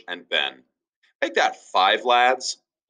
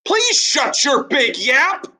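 townsfolk's retort; 4 dB louder, slower than usual. Acted. Townsfolk's saying for Ben.

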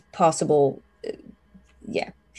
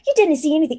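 put-on high, loud girlish scoffing; a young maid brushing off her friend; teasing teen maid; 8 semitones higher, 5 dB louder, faster than usual. GM voicing maid Katie scoffing at Teresa, high-pitched.

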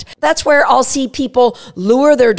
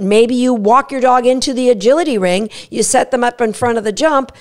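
ordinary delivery

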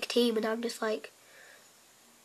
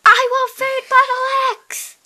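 much higher, much louder yell; excited gamer yell; sudden shout; 12 semitones higher, 10 dB louder than usual. Real, not acted. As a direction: blurt it out as a loud high shout. Shouting during game.

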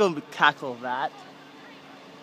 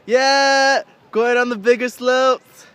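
higher and louder, slow and triumphant; rally the viewers like a kickoff; excited cheer; 8 semitones higher, 10 dB louder, slower than usual. Real, not acted. Cheering to viewers before the bigger slopes, excited and loud.

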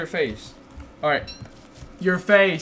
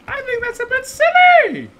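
silly voice; 6 dB louder than usual. Acted. Sudden put-on silly voice.

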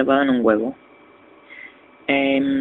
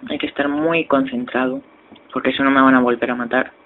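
ordinary delivery

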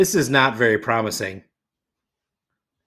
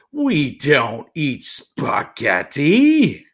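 slow, mild growl of a lion; a lightly growled, unhurried no; slower than usual. A narrator's reading. Lion growls at the boy asking for spaghetti, slow mild growl. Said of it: "The lion growled".

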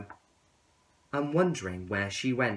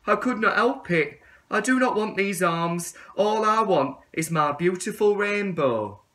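higher, louder pleading; begging tadpole; 8 semitones higher, 8 dB louder than usual. Acted. Tadpole begging the caterpillar for forgiveness, higher and louder. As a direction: plead for another chance. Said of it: "begged the tadpole".

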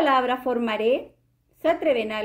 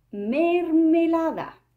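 "mermelada" drawn out slowly; stretched reveal; 4 semitones higher, slower than usual. Shown as a demonstration. Teacher revealing the answer word slowly. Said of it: "un objeto que comienza con la sílaba me es".

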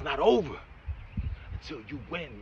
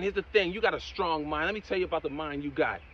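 normal talking voice

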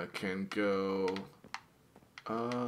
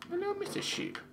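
silly high greeting. Acted.

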